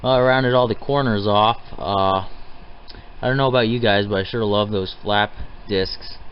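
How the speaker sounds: usual speaking voice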